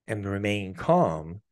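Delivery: ordinary talk, nothing marked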